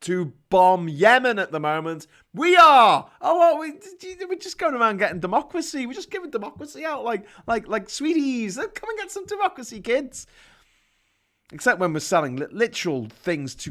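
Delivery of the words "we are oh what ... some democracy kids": high-pitched sarcastic hawking; hammed-up, high sing-out sales pitch; mock eager peddler. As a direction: hand it out like sweets to children. Sarcastic act of handing out democracy.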